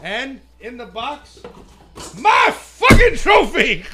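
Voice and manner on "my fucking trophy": loud, high, slow victory yell; triumphant shout. Real, not acted. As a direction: roar it out like a champion. Unveiling his trophy in triumph.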